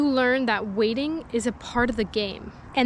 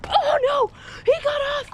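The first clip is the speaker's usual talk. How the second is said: very high, loud shriek of dismay; high panicked yell; dismayed cry; 3 dB louder than usual. Real, not acted. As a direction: shriek as the fish slips off. Fish escapes.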